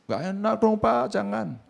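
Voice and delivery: high, pleading protest; a student's high-pitched whine of refusal. Retold or imitated. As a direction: beg the teacher to let you off. Student pleading in retold scene.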